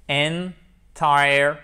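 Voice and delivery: "Entirely" in three slow, separate pieces: en, tire, ly; syllable by syllable. Shown as a demonstration. Teacher demonstrating an adverb, syllable by syllable. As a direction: break "Entirely" apart, loud on TIRE.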